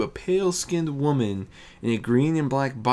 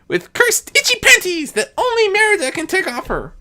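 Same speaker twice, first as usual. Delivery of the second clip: put-on much higher, louder young trickster voice; Discord as a boastful youth; 9 dB louder than usual. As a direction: brag it like a mischievous young god. Discord's voice, held.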